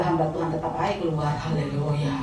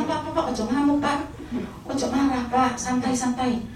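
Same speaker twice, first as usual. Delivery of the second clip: high-pitched pleading to settle down; imitated calming down; 7 semitones higher than usual. Passenger calming the raging taxi driver, placating. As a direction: urgently placate someone, voice raised in pitch. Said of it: "Supir ini ngamuk".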